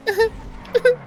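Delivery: a sudden high-pitched giggle sound; high odd laugh. Retold or imitated. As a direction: squeak out a strange high laugh. Krista's strange sound during sex.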